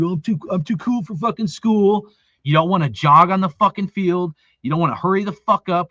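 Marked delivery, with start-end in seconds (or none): 0.0-2.1 higher-pitched mock of a too-cool kid; mocking cocky attitude. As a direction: mimic the kids' attitude scornfully. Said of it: "walking with your fucking attitude".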